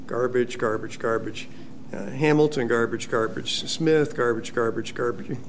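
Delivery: soft, dismissive muttering of each word; mock mail sorting. Pastor imitating colleague sorting junk mail, soft and dismissive. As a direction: toss each piece aside under your breath.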